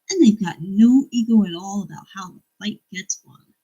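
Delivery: reading a book aloud. Acted. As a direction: deliver the old man advice with relish. Reads another quote.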